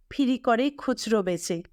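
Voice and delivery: plain reading voice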